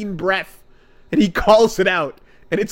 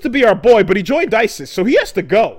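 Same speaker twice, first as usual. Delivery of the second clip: hyped-up group voice, louder and higher; rally the crew like a loud bro; loud bro voice calling him out; mock rally; 5 semitones higher, 5 dB louder than usual. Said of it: "and they all go".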